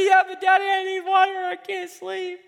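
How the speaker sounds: put-on a child begging at bedtime; whiny child. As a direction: whine like a child stalling bedtime. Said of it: "They come up with every excuse".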